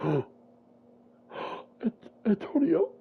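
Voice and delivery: high cracking cry of the name; sobbing cry. Retold or imitated. Jalen breaking down over his brother's body, sobbing. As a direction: wail the name in grief.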